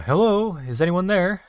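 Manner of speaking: loud, high call into the dark. Pretend. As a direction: shout it out to find someone. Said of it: "you then call out".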